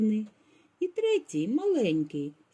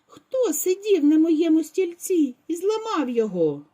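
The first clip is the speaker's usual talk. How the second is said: higher, louder cub squeak; squeaky voice; 8 semitones higher, 6 dB louder than usual. A narrator's reading. Baby bear squeals about his broken chair, high. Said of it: "пропищав".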